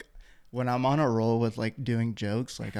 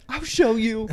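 higher, louder hammed-up boast of a gym bro; mock tough guy; 12 semitones higher, 6 dB louder than usual. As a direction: puff up like a bragging lifter. Coworker boasting he'll bulk up.

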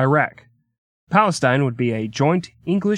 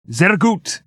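"Sehr gut" with a German accent, a touch higher; German praise; 7 semitones higher, 3 dB louder than usual. Shown as a demonstration. Repeating the German word for very good, in a German accent.

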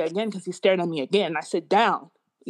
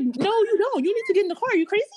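high-pitched, incredulous telling-off; retold exasperated scolding; 11 semitones higher, faster than usual. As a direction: re-enact arguing with mom in urgent disbelief. Retold argument with mom.

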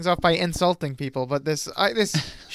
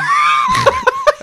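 shrieking laugh; 7 dB louder than usual. Real, not acted. Bursts out laughing.

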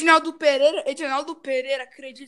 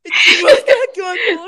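laughing; 4 semitones higher than usual. Pretend. Laughing mock creepy offer.

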